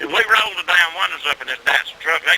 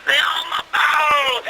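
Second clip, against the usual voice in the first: slow pained holler; 10 semitones higher, slower than usual. Retold or imitated. Billy yelling to the boys about the bee stings. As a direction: drag it out in a stung man's holler. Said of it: "He said".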